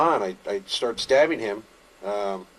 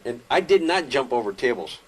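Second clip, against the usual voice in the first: normal talking voice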